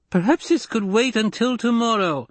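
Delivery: high-pitched, careful plea; tentative suggestion. Acted. Doctor trying to calm the angry lady, tentatively. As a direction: suggest it cautiously, trying to placate.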